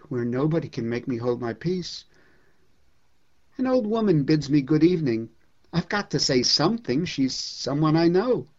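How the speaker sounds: poem read with mounting rage; dramatic poem reading. Acted. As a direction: perform the poem like a stage piece, rising to a frenzy. Reads Mayakovsky poem aloud dramatically.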